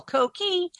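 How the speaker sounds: high chirping frog call; coqui frog call. Retold or imitated. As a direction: chirp it like a tiny tree frog. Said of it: "that high-pitched call".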